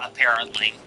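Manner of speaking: normal talking voice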